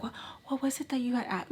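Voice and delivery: fake nonchalant. Mimicking how she started her questions, fake nonchalant. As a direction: mimic her rushing softly through a would-be casual question. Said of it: "trying to be, like, nonchalant".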